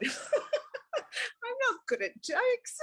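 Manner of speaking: high, slow laughing through the words; real flustered laugh, drawn out. Real, not acted. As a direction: giggle it out, embarrassed. Embarrassed by a request for a joke.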